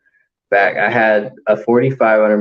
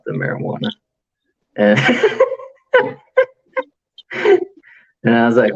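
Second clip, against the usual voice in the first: ordinary talk, nothing marked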